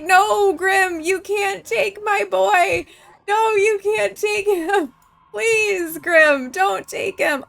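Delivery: high, drawn-out, hammed-up begging; pleading mother. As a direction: beg loudly for your son's life, theatrically. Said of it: "Plead for your son. Be like".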